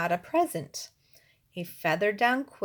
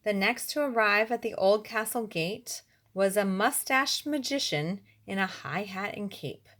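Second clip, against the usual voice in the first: normal talking voice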